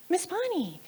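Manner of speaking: high, small imitated child; small child's voice. Little boy reaching out to his teacher, small high voice.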